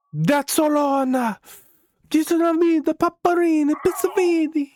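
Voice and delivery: high, hammed-up fake Italian accent; mock Italian accent. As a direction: play a cartoon Italian, way up high. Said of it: "I'm kidding".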